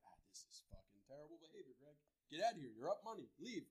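low, hushed talk to himself; muttered self-scolding. Retold or imitated. Gambler telling himself to leave the table, muttered self-scolding. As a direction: replay a quiet inner telling-off.

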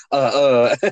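mock stammer on filler sounds; imitated stumbling. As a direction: imitate someone stuck for words. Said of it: "stumbles over his words a lot, so he'll be just like".

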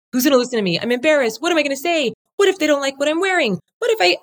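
high, mock-anxious inner voice; nervous beginner. Speaker voicing a timid newcomer's worries, mock nervous. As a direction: fret like someone scared of the camera.

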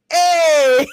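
loud high greeting; shout. Real, not acted. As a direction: call it out loud.

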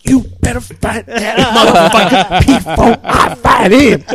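loud, high, slow hammed-up yell; shouted tough-guy imitation; mock threat. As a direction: draw out the threat while breaking into laughter. Prank caller threatens in acted quarrel.

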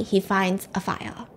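usual speaking voice

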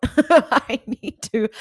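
loud, high, drawn out through laughter; laughing. Real, not acted. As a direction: laugh right through the words. Laughs at her own outburst.

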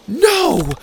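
high, loud cry of "no"; desperate high-pitched shout; yell. Acted. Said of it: "I yelled".

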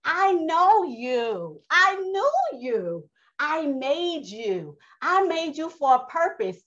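high, very slow, drawn-out declaration; Yah speaking. Acted. Yah telling the congregation He made them, slowly proclaimed. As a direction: stretch each statement out like a divine decree. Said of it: "that's what Yah is saying to us".

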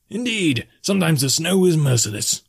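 high, hearty barkeep; high-pitched, knowing agreement; agreeing bartender. A narrator's reading. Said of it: "the bartender agreed".

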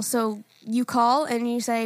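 normal talking voice

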